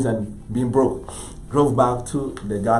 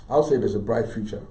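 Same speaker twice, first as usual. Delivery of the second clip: ordinary delivery, nothing marked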